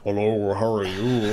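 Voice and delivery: low, put-on manly greeting; really male voice. Answering friend's girl voice with a really male, low voice. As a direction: drop the pitch for a mock manly voice. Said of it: "really male voice then".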